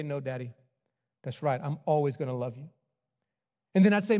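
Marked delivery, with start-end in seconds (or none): 0.0-2.7 lightly voiced little son, softer; a hint of a small boy; small child answering. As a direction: son answering daddy quietly.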